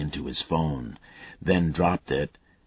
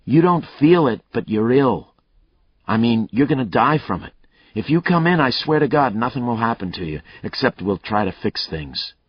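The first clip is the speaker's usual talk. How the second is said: raised, pressing appeal; urgent plea; 7 semitones higher, 7 dB louder than usual. Acted. Detective pleading with the killer to come in, urgent. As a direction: talk him into surrendering.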